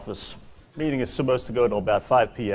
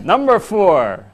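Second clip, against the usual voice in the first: higher, projected game-show style delivery; big comic top-ten reveal; countdown announcer; 7 semitones higher, 3 dB louder than usual. Acted. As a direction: announce each entry with mock grandeur.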